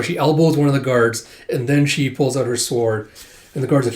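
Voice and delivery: ordinary talk, nothing marked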